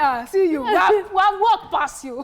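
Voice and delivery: high, slow mocking retort; mocking passengers. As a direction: drawl it back at the driver. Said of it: "They said".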